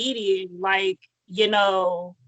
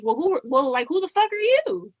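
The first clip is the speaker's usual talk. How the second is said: indignant; 8 semitones higher than usual. Real, not acted. Angry rant at Tom.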